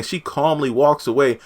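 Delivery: ordinary talk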